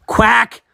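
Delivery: high, loud quack; duck quack. Acted. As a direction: blurt out a sudden duck call. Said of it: "I keep quacking".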